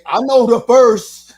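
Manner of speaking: loud, slow mock objection; standing up at a wedding to object, loud and slow. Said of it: "and say".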